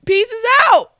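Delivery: high, loud sign-off; loud, high-pitched cheeky exit line; cheeky farewell. Acted. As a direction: toss out a big high-pitched goodbye. Acted departing mom's farewell.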